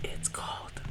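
soft breathy whisper; ASMR whisper. Pretend. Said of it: "This is not A S M R".

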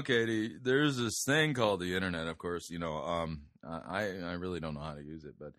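a drowsy, mumbling divine voice; Holy Spirit voice. Retold or imitated. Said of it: "I gotta use my Holy Spirit voice".

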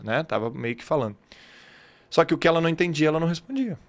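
usual speaking voice

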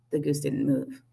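usual speaking voice

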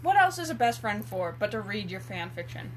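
ordinary talk

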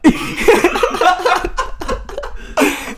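high, breaking-up laughing fit; helpless laughter. Real, not acted. Storyteller losing it over the next story, helpless laughter. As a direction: crack up before you can tell it.

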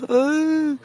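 very high mock toddler wail; crying wail. Imitating the little boy crying at the game. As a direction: squeal it like a bawling little kid.

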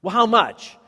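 loud, high-pitched acted demand; pressing challenge. Pastor quizzing member who claims to have prayed, pressing challenge.

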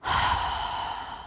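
short, heavy breath out; releasing sigh of air; big exhale. Shown as a demonstration. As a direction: breathe out audibly in one voiceless gust. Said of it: "With a big exhale".

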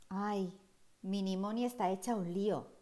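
plain reading voice

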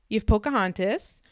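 normal talking voice

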